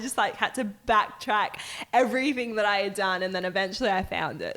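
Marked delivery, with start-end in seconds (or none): none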